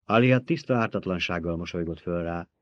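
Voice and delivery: ordinary delivery, nothing marked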